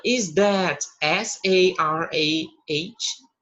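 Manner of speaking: letters spelled out very slowly and loudly; spelling slowly. Shown as a demonstration. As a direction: spell each letter apart for the class. (soletrando bem devagar)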